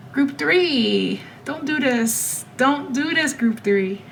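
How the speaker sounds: pleading, pitch high and voice near breaking; close to tears. Real, not acted. Begging group three viewers, near tears. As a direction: hold back tears as you beg. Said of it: "I'm about to start crying".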